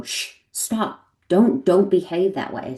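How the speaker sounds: shushing parent. Retold or imitated. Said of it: "instead they go".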